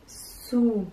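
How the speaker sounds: "souris" as sou... ris; slow "souris" with a gap between syllables; split into syllables. Shown as a demonstration. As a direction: sound out "souris" in two beats. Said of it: "des syllabes qui composent".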